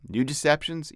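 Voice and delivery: ordinary delivery, nothing marked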